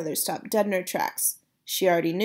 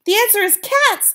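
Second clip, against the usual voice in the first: high, loud triumphant shout; boy shouts; 12 semitones higher, 7 dB louder than usual. Acted. Boy reveals the answer to grandmother, loud high shout. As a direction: announce it at the top of your voice. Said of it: "I shouted".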